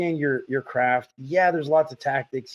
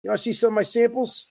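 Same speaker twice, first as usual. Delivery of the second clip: higher-pitched imitation of a Brooklyn dad; mock Brooklyn, raised pitch; Brooklyn accent; 10 semitones higher than usual. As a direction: pitch samples like a Brooklyn salesman. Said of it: "my dad, a real Brooklyn accent".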